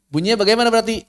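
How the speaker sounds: ordinary talk, nothing marked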